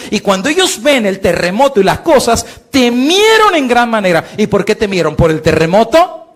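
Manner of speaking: high, loud fiery sermon delivery; full-force preaching. Acted. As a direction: preach it hard to the congregation.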